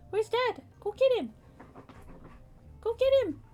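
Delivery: sing-song, high-pitched talk to a pet; cheerfully egging the dog on to fetch dad. Real, not acted. Urging dog to find dad.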